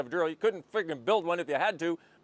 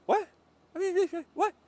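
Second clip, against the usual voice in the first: incredulous what; 14 semitones higher than usual. Acted. Mock disbelief at own jargon.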